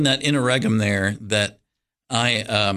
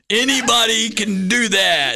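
laughing; 10 semitones higher, 4 dB louder, slower than usual. Real, not acted. Laughing while retelling thought.

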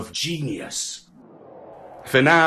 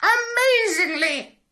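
parrot squawk. A narrator's reading. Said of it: "squawked the parrot".

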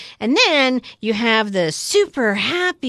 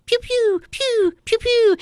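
laser sounds; 12 semitones higher than usual. Retold or imitated. Imitating laser shots.

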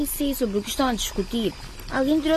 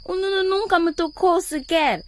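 slow, higher, crying through the words; tearful protest; 6 semitones higher than usual. Acted. Girl accuses her ex-boyfriend, crying. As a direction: hold back sobs as you deny it. Maria confronts Daniel tearfully.

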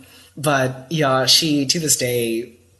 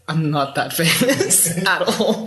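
laughing; 5 semitones higher, 6 dB louder, slower than usual. Retold or imitated. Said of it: "And I was like".

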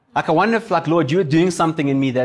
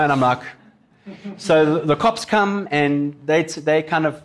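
usual speaking voice

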